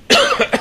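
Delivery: sharp cough, throat still tickled; just swallowed a bug. Real, not acted. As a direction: cough up the bug mid-sentence. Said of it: "That bug".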